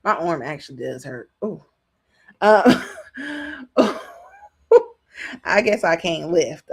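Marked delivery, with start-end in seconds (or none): 2.9-4.0 high laugh over an aching arm; high-pitched laugh at own struggle; pained laugh. Real, not acted. Arm hurts while reaching back.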